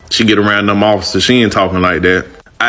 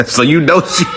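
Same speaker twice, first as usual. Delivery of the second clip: higher, breaking into laughter; real laughing; 6 semitones higher than usual. Real, not acted. Commentator mocking her lack of a boyfriend, laughing. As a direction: laugh through the words.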